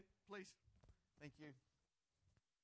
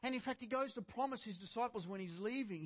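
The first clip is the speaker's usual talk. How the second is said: normal talking voice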